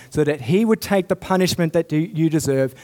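ordinary talk, nothing marked

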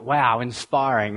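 low, deadpan mock admiration; unimpressed irony. Preacher reacting to an old wartime recruitment slogan, drily unimpressed.

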